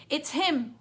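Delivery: officer spotting the fugitive; policeman yelling. A narrator's reading. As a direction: shout it out to the others. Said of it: "one of the officers yelled".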